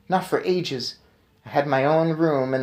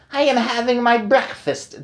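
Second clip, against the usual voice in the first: put-on much higher witch voice with accent; witch's cry; 9 semitones higher, 3 dB louder than usual. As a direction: complain theatrically to the witches. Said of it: "cried the Grand High Witch".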